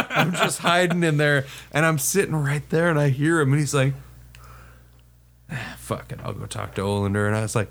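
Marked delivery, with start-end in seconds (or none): 5.5-7.3 low, soft, giving-up mutter; imitated muttering to himself. Sergeant abandoning the search beside the dumpster, low resigned mutter. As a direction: give up under your breath. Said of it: "I hear him thinking out loud to himself".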